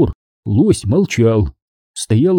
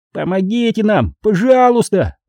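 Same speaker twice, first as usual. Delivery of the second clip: high whiny plea; tearful-sounding high gnome plea; whining; 9 semitones higher than usual. A narrator's reading. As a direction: beg high, whining on the last word. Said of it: "заныл Фикс со слезами на глазах".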